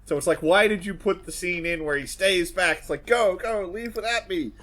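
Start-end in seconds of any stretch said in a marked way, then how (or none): 3.1-4.5 higher-pitched mock self-sacrifice; hammed-up urgent movie-hero plea; mock heroic plea. Acting out film's heroic sacrifice line.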